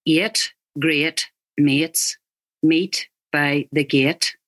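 slow Northern Irish vowels, eight as eet; Northern Irish accent. Shown as a demonstration. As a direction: stretch eight great mates the Northern Irish way, slowly.

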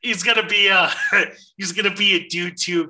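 usual speaking voice

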